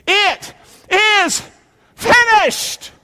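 very high, emphatic proclamation; triumphant cry. A narrator's reading. Preacher leading congregation in Jesus' last words, high triumphant cry.

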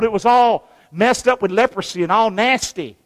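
usual speaking voice

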